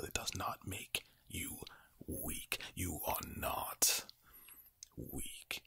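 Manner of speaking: usual speaking voice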